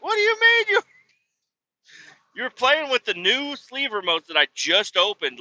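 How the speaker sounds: high, squawking retold indignation; incredulous outrage. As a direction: throw it back in mock disbelief. Retold outraged reply to child.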